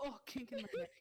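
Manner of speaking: high strained voice of pain; pained groan. Acted. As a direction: wince through a stiff neck. Acted pained neck quote.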